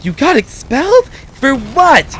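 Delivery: high, shocked mom; a lightly voiced mom. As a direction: gasp it out as the mother. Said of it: "She's like".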